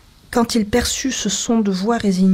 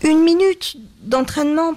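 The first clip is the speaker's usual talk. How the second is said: finally getting it out; 7 semitones higher, 3 dB louder than usual. A narrator's reading. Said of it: "prononça-t-il enfin".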